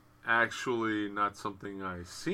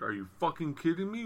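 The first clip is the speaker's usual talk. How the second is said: disbelief; 7 semitones higher, 3 dB quieter, faster than usual. Retold or imitated. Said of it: "Conrad was like".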